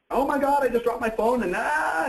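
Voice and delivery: high-pitched mock panic, rising to a wail; anxious overreaction. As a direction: act out worrying wildly over something small. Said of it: "The anxious person isn't calming down".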